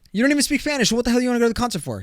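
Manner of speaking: high, fast, incredulous rant; mock indignant dad. Dad argues kid out of concert, mock indignant.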